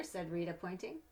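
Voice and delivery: storybook narration, gentle and lively; reading to children. Acted. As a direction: read it like a bedtime picture book. Reads picture book aloud.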